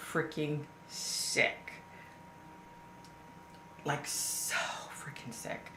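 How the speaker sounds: slow, breathy whisper of real nausea; sick whisper. Real, not acted. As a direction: let it drain out of you, barely voiced. Reliving nausea watching footage.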